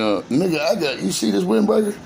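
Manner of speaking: ordinary talk, nothing marked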